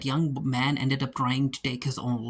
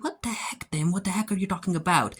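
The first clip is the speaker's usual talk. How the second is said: incredulous; 5 semitones higher, faster than usual. Acted. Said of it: "And Hawks like".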